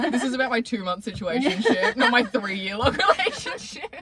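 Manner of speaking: loud mock clarification, laughing. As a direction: laugh through the joke. Mock lyric explanation, laughing.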